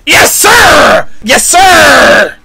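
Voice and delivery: high, elated shouts; triumphant cheer. Real, not acted. Viewer cheering the hero, triumphant shouts.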